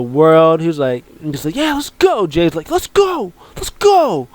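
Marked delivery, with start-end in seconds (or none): 1.5-2.3 pitched way up, cheering "let's go"; a big hyped cheer from the cousin; excited. Retold or imitated. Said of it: "Man, I'm so good at acting". 2.7-4.3 much higher, pumped-up chant; all hyped. Retold or imitated. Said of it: "He's all hyped".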